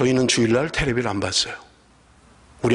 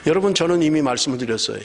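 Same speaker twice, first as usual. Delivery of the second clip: ordinary delivery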